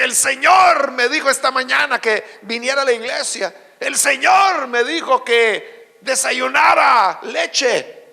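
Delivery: loud, drawn-out proclamation; mock pious testimony. Church member boasting that God speaks to them, mock pious proclamation. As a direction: imitate a self-important believer announcing divine orders. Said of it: "Porque es gente que así habla".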